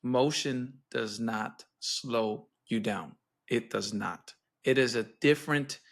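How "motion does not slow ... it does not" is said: slow, low, deliberate declaration; blunt and emphatic. Real, not acted. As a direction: lay it down firmly, word by word. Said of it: "tell you straight to the face".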